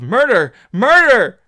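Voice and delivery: frantic cries for help, rising in pitch; cries of murder. Acted. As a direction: scream it in terror. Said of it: "The cries of".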